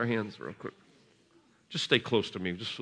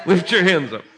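higher, louder, laughing as he calls; 8 semitones higher, 7 dB louder than usual. Real, not acted. Preacher calling to the kids, higher, louder and laughing. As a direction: call through laughter.

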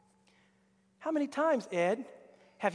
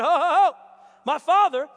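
higher, louder chuckling address; laughing servant; 5 semitones higher, 8 dB louder than usual. Retold or imitated. Servant teasing Naaman, loud laughing. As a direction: laugh him gently out of his pride.

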